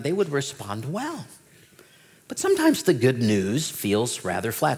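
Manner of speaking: ordinary talk, nothing marked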